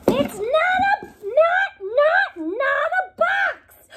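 high, loud, slowing frustrated yell; childlike fed-up outburst; exasperated shout. Acted. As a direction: splutter it out in frustration. Bunny exasperated, stammering.

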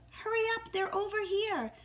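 very high, urgent mouse voice; high-pitched excited little call; baby mouse calling. A narrator's reading. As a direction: call friends over like a tiny mouse. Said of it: "a baby mouse called out".

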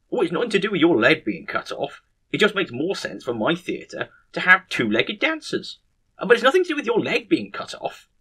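high-pitched, protesting excuse; defensive manager. Acted. Manager excusing cancelled shows to the nurse, high defensive protest. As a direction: insist innocently, pitch climbing on the repeat.

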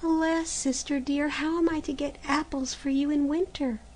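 put-on pleading girl. A narrator's reading. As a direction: plead gently, bewildered. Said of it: "protested Maruschka".

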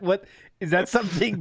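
ordinary talk

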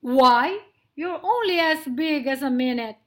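loud, quick, mocking lion; amused scoff. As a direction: scoff loudly at a tiny mouse. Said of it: "laughed Lionel".

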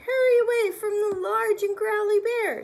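high, loud panicky cry; cried out. Acted. Bear crying out to flee. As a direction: yell it in a panic. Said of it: "he cried".

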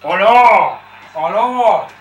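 loud startled exclamations; shocked outburst. Real, not acted. Fan seeing players fouled, crying out in shock. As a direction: cry out in disbelief.

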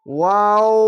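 ওয়াও held long, levelling out; chant-like, long-held waw; three-alif stretch. Shown as a demonstration. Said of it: "তিন আলিফ টেনে পড়বেন".

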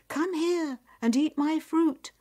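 high, loud beckoning call; inviting tree. Acted. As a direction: lure the traveller over. Magic tree lures Nyap.

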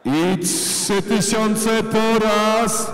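high drawn-out auction calls building; auctioneer. Real, not acted. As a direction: stretch each call for suspense. Auction climax.

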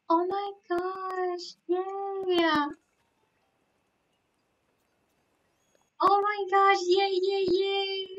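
high, singsong cheering; giddy high squeals of joy; delighted squeal. Real, not acted. Celebrating a photo in game.

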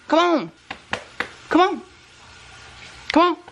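high coaxing call; short high-pitched calls to a pet; calling the dog. Real, not acted. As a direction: coax a hesitant dog to come. Calling her dog for snack.

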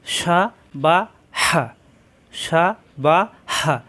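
সা বা হা spaced out, each syllable slow; slow, separate syllables. Shown as a demonstration. Teacher spelling out syllables slowly before joining them. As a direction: lay out সা বা হা one by one. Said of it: "হারকাতের উচ্চারণ তাড়াতাড়ি".

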